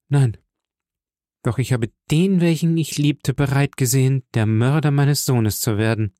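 understated pain in every word; a touch of despair, held back; mild anguish. Acted. Mercedes answers the count with mild anguish. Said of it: "mit einem so mächtigen Schmerz".

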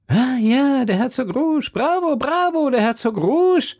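high, exuberant cheering; begeisterter Jubel. A narrator's reading. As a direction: cry bravo in delight at the joke. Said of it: "rief Porthos und klatschte mit den Händen". (begeistert jubelnd, mit hoher Stimme)

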